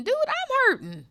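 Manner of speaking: high, loud preaching cry; full-force pulpit preaching; impassioned preaching. Acted. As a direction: preach it out with rising fervor.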